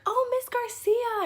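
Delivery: high, loud, drawn-out sing-song greeting; yard duty calling out. Retold or imitated. Yard duty spotting her mom at pickup, high and loud sing-song greeting. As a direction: call out the mom's name like a cheerful school aide.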